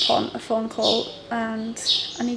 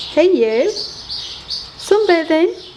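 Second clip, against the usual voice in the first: pet talk; 7 semitones higher, much slower than usual. Real, not acted. Talking to a sunbathing pet.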